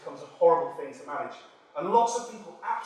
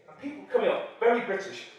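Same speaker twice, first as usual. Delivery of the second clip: normal talking voice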